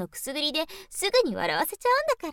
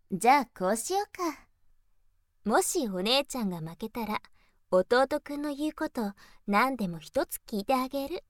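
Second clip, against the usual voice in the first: normal talking voice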